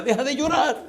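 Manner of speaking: high mock yell; retold yelling. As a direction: imitate a desperate high-pitched shout. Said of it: "el tipo empieza a gritar". (grito agudo imitado)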